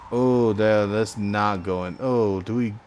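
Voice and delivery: ordinary delivery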